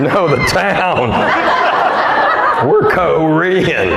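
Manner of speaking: slow, lightly put-on mother-in-law voice; a hint of his mother-in-law, drawn out slowly; imitating mother-in-law. Telling the gas station guy off, slow mild impression of mother-in-law. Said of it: "That's how my mother-in-law talks".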